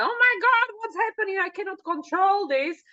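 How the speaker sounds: high, loud mock panic of the ego. Ego freaking out, high mock panic. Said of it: "it goes freaking out".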